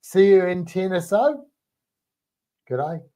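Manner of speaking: mock Australian sign-off; Australian accent. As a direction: say it like a pretend Aussie. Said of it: "That's how I want you to all sound".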